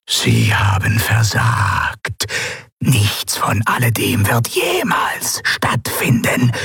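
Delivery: extremely slow, ominous verdict; an otherworldly being passing judgment, words stretched out; Sylravan. Acted. Sylravan verkündet das Urteil. (unheimlich langsames, gedehntes Urteil)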